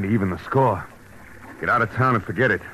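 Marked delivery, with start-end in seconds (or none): none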